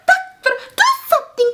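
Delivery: very high, hammed-up alarm; mock panic. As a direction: plead in put-on panic. Acted panic at crazed monster.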